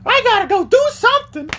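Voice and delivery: high falsetto; high-pitched lady; old lady voice. Retold or imitated. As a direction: chirp it in a high granny voice. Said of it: "she's like".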